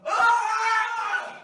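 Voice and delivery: high pained yell with a laugh; pained yelp. Real, not acted. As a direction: yelp out from the burning heat. Ghost pepper burning his mouth.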